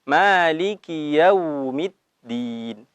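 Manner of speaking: slow Maliki Yaumiddin; Quranic recitation. Shown as a demonstration. (bacaan tartil pelan)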